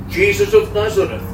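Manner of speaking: normal talking voice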